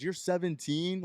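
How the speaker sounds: high, drawn-out incredulous question; loud disbelief. Retold or imitated. Cop exposes the underage student to the room, incredulous. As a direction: blurt it out, imitating a shocked cop. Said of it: "Like, out loud".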